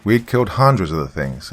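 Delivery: usual speaking voice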